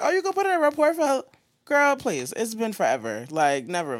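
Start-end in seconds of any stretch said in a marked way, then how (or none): 0.0-1.2 high, fast mock of a careless clerk. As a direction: rattle it off like a clerk who doesn't care. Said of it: "She was like".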